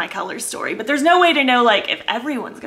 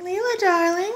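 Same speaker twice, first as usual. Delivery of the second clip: cooing to a pet; 7 semitones higher, 5 dB quieter than usual. Real, not acted. Owner saying hi to her darling cat, tender cooing. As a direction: coo gently and sweetly to a cat.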